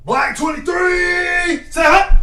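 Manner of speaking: high, loud, slow drawn-out snap count; own cadence shout. Pretend. Player showing off his own cadence, high drawn-out shout. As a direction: holler it long, showing off your cadence. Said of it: "I'm finna do my own cadence".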